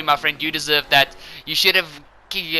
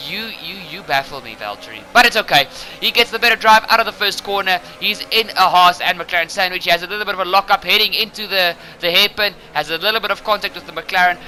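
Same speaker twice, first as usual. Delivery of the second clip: ordinary talk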